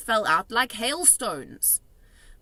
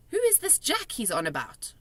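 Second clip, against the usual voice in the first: usual speaking voice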